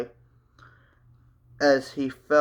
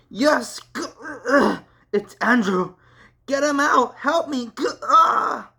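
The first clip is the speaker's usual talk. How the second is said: pained struggling cry; 11 semitones higher, 5 dB louder than usual. Acted. Matt fighting the possession.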